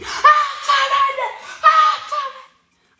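very high, drawn-out shriek; wild excited screaming, as if alone; demonstrated scream. Shown as a demonstration. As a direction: scream it at full pitch, stretched long. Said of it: "ich hätte so geschrien".

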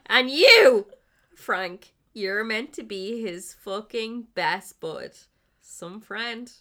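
loud, scornful yell at the friend; furious accusation. Acted. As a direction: tear into Frank for betraying his mate. Ray still raging at Frank.

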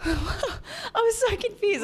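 slow, high, laughing through it; breaking up with laughter. Real, not acted. Laughing while retelling.

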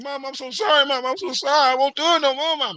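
high pleading mock sob to his mom; mock crying plea. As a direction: son begging his mom to stop, in a hammed-up crying voice. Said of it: "I'm crying, everything else".

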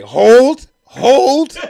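loud, high-pitched interruption; front-row heckler. Retold or imitated. Lady in the front row halting the comic, loud. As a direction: call it out over the act. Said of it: "fucking lady in the front row".

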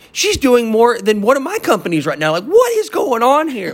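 gushing with proud disbelief; amazed. Retold or imitated. Telling how his girlfriend outsold his companies, amazed.

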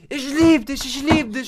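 the dog explodes with joy; ecstatic dog voice. Retold or imitated. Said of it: "Und was macht er?". (sehr hohe, laute Hundestimme)

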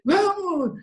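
high, drawn-out keen cheer; eager toddler intonation. Acted. Church brother replying to an invitation, imitated toddler eagerness. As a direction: answer like an over-eager little kid. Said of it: "É a entonação da Emily".

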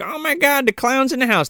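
high-pitched pretend alarm; mock panic. Retelling the women fleeing the clown, high mock panic.

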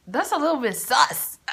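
giggling through the words; laughing. Real, not acted. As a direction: laugh it out, amused at yourself. Laughing at her own secret.

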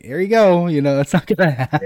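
high, loud, quick mock quote; mock offer. Host imagining what Savathun would say, mocking and loud.

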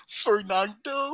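slow, laughing [name] call; [name] [name]. Pretend. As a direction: drag out [name] through giggles. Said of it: "an accent now".

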